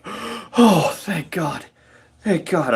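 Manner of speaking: mock nurse breathing out relief; relieved nurse. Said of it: "sighs audibly".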